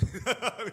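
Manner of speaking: short, high, loud chuckle; amused laugh. Real, not acted. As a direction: laugh out loud at your own story. Laughs at own drama anecdote. (재미있어서 터진 웃음)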